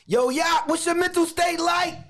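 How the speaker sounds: high, loud call across the room. Real, not acted. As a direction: hail your brother loudly to get his attention. Calling out to his brother.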